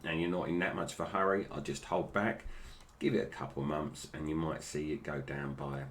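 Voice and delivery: ordinary talk, nothing marked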